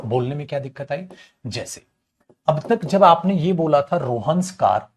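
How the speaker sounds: ordinary delivery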